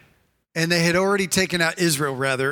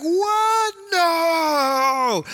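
high, wailing "what" and long drawn-out "no"; hammed-up howl of disbelief, pitch way up; shocked protest; 10 semitones higher than usual. Preacher playing the listener hearing bad news, wailing in shock. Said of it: "You're like".

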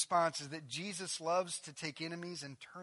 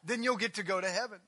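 higher, louder mock pleading voice; mocking legalist; 10 semitones higher, 16 dB louder than usual. Pastor mocking what Jesus didn't say, loud parody. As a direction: parody a fussy rule-maker. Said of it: "He says".

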